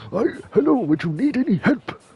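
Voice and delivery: teeth-gritted high mock voice; forced through teeth. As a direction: squeeze it out through clenched teeth. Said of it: "forcing it through his teeth".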